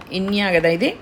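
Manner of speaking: ordinary talk, nothing marked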